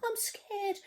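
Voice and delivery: high, whimpering "I'm scared". Acted. Storyteller voicing the cook, high and whimpering. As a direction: whimper it like a timid child. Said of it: "the cook declared".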